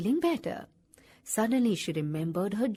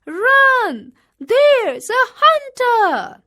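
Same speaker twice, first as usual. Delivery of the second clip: much higher, louder, slow scream; 12 semitones higher, 12 dB louder, much slower than usual. A narrator's reading. Kangaroo screams to the wombat about the hunter, high and loud. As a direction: scream the warning at full force. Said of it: "she screamed to the wombat".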